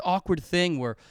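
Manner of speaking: usual speaking voice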